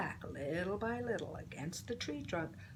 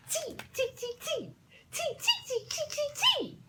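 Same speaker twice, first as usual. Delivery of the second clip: very high laughing tee tee; giggling monkey chatter; 3 dB louder than usual. Acted. Monkeys laughing at the peddler, squealing high. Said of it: "monkeys ... said".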